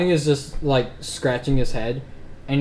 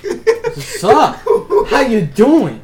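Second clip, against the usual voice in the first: much higher, laughing bro greeting; hyped greeting; 11 semitones higher, 6 dB louder, much slower than usual. Acted. GM voicing an NPC greeting Adonis with a higher, laughing hype. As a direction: greet your buddy like you haven't seen him in ages. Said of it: "you watch him dap up Adonis".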